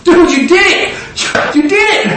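high, stretched "dude" congratulation; imagined Jesus cheering. Acted. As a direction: cheer them on in delighted disbelief. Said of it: "I can imagine Yehoshua going".

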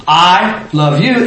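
slow, with "I" held out first; stress on I. Shown as a demonstration. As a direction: lean hard on "I" before "love you". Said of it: "Emphasis is on".

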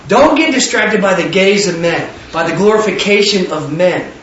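ordinary talk

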